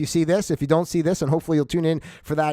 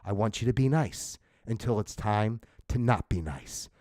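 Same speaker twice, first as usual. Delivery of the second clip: movie quote; 7 semitones lower, 7 dB quieter than usual. Retold or imitated. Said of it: "Remember, like, in, in Roadhouse".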